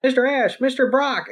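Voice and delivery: high mock kid voice; kid fans calling. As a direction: call out to the heroes like a small fan. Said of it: "You know".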